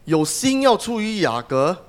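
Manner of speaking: normal talking voice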